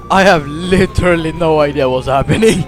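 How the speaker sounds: ordinary talk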